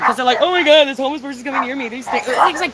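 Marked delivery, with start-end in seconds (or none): 0.4-1.9 fast, panicky mimicry of squeamish people; mock someone alarmed by who is approaching; hurried, put-on alarm; mock scared. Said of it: "'cause people are so scared".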